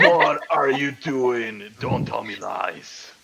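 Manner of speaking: very slow, drawn-out intimidation; scary voice. Pretend. Player intimidating the burgomeister's wife, mock scary voice. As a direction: draw every word out to menace her, hammed-up. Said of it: "Then say it in a scary voice".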